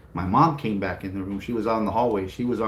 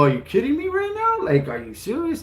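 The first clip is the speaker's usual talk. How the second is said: outraged disbelief. Retold or imitated. Boy to his dad, a high outraged protest. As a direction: squeal it in outrage at your dad.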